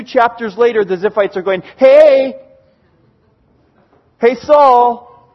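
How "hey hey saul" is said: loud, raised hail in a retelling; calling out. Retold or imitated. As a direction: holler for someone's attention from afar. Ziphites call out to Saul.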